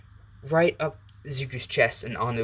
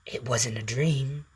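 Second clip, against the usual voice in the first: slow, drawn-out symbiote statement; Venom; slower than usual. Acted. Symbiote curls round the waking boy, slow and sure. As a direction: stretch it out as a creeping correction. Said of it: "Venom kinda curls around him and goes".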